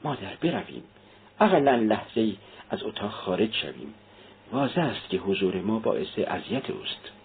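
hushed, worried urging; soft and uneasy; anxious, low voice. A narrator's reading. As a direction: speak softly so only mother hears. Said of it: "با اضطراب آهسته گفت".

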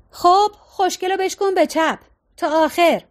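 shouted instruction. A narrator's reading. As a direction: call out a driving direction. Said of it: "آیرا داد زد".

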